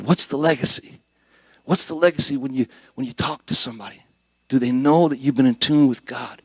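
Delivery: ordinary delivery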